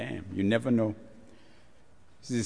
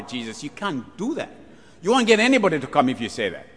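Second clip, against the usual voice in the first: protesting Peter; 6 semitones higher than usual. Acted. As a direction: argue him out of it.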